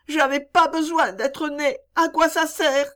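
put-on high, despairing child's voice; a child who wishes she had never lived; despairing little girl.

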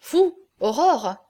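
a slow admiring protest on Vous. A narrator's reading. Lagardère to Aurore, who doubts her beauty, slow admiring protest. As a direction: linger over Vous, Aurore in disbelief.

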